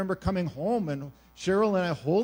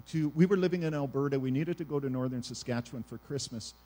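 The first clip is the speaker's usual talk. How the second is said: usual speaking voice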